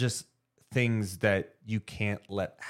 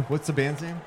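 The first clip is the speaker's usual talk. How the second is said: ordinary delivery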